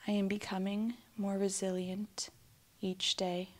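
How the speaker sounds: calm, unhurried affirmation with long silences after; spoken affirmations. Acted. Guide giving affirmations for listeners to repeat, calm meditation voice. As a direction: say each statement gently, leaving room to repeat it.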